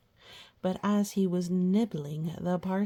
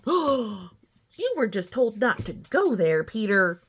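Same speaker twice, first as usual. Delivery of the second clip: mock scolding; 3 semitones higher, 6 dB louder than usual. Scolding Peter.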